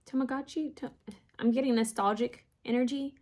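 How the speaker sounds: usual speaking voice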